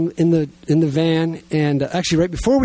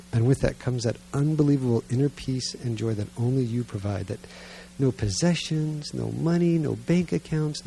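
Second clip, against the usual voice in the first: sincere, subdued prayer that grows softer; 4 dB quieter than usual. Real, not acted. Preacher praying for the congregation after the sermon, sincere prayer. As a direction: pray earnestly, unhurried, settling into quiet.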